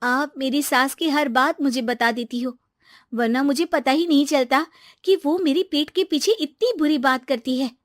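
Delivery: put-on aggrieved young wife confiding; chummy daughter-in-law. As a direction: gossip sweetly about your mother-in-law. Daughter-in-law figure complaining to neighbour.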